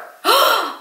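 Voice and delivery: a sharp high gasp of delight; excited gasp. Real, not acted. Thrilled to hear the bread is homemade, a high gasp. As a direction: gasp in wonder.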